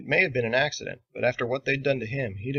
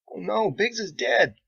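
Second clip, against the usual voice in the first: panicked; 11 semitones higher, 5 dB louder, slower than usual. Acted. Sailor sees Biggs go stiff.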